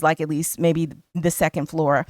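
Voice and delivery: usual speaking voice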